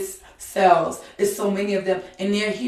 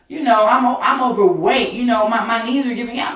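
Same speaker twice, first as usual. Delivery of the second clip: fervent preaching; 4 semitones higher, 6 dB louder than usual. Acted. Preaching at full force.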